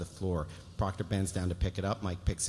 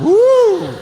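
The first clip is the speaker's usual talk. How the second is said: high, very loud "woo"; excited whoop; 19 dB louder than usual. Real, not acted. Table reader cheering the scene's end, loud high whoop. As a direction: let out a shrieking cheer.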